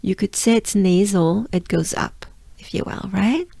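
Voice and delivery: ordinary delivery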